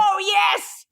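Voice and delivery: real high, shouted delight; excited cheer. Real, not acted. Co-host hearing of a second season, excited shout. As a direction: shout it with elated triumph.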